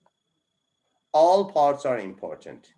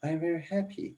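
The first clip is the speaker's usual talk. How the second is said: higher happy; 5 semitones higher, 6 dB quieter, much faster than usual. Shown as a demonstration. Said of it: "It's monotone".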